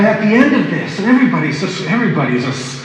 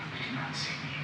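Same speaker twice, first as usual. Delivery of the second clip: whispered echo; 21 dB quieter, faster than usual. Retold or imitated. Retelling her hurt question.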